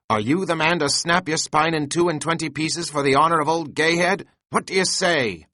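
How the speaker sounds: high, loud, rousing challenge to the harpooneer; Stubb rallying. A narrator's reading. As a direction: fire up the crew with a big shout. Stubb rallying crew, Tashtego.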